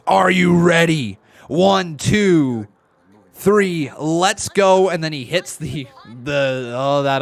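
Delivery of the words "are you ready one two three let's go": loud, drawn-out countdown call; reading out donor's message. Retold or imitated. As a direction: belt it out like a hype countdown. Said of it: "He says".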